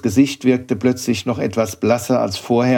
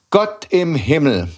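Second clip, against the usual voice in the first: slow mock exclamation, a touch higher; exclaimed; 6 semitones higher than usual. A narrator's reading. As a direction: cry it out in mild dismay, slowly. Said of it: "rief Herr Koriander". (langsamer, leicht gespielter Ausruf)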